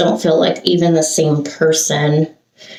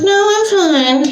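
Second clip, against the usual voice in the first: high, slow, falsely bright "No, I'm fine."; fake fine; 14 semitones higher than usual. Telling husband she's fine, in a high put-on voice. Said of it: "I'm like".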